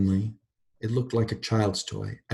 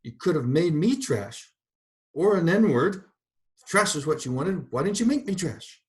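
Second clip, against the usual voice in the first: higher, bitter accusations; railing; 8 semitones higher, 4 dB louder than usual. A narrator's reading. Mrs. Turpin railing at God, bitter. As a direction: throw the questions skyward.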